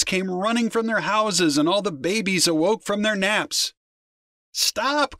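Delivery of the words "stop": high-pitched cry to stop; mothers' high call. A narrator's reading. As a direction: call out high, without much volume. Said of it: "cried the mothers".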